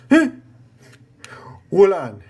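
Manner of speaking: normal talking voice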